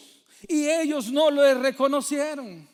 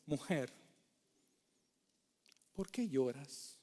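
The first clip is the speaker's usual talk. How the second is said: much softer, low and hushed; soft gentle question; 10 semitones lower, 14 dB quieter than usual. Acted. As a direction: ask it tenderly, almost under your breath. Angels gently question weeping María.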